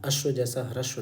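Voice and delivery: ह्रस्व said hrashva, like अश्व; wrong pronunciation. Shown as a demonstration. Teacher warns learners of a common error, ह्रस्व mispronounced with sh. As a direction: put a sh sound into ह्रस्व to match अश्व. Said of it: "ये गलत उच्चारण है".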